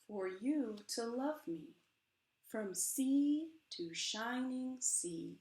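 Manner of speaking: slow, swelling spoken-word performance of a poem; dramatic poem reading. Acted. Author reads her book to the audience, impassioned poem reading. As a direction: perform the poem with rising passion.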